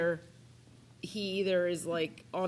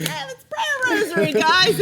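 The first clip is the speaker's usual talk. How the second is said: cheerful dad; 12 semitones higher, 11 dB louder than usual. Retold or imitated. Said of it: "they're like".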